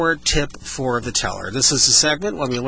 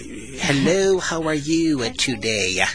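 drawn-out greeting, pitched up; overly slow; 3 semitones higher, slower than usual. Shown as a demonstration. Host mocking slow delivery to a listener. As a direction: exaggerate the slowness as a joke. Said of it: "you want to speak real slow".

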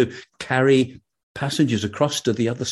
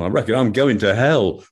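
ordinary delivery

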